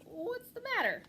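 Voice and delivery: high little mouse voice; small mouse voice. A narrator's reading. Mouse asking the pack rat, high little voice. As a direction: ask with tiny concern.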